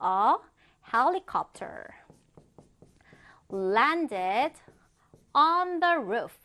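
long pauses between phrases; slow chunked reading. Shown as a demonstration. Teacher modelling a helicopter example for students, slow chunked reading. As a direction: give it piece by piece for learners.

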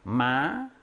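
마 sliding smoothly upward; 마 drawn out with a clear rise; rising tone. Shown as a demonstration. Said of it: "위로 올라가는 거".

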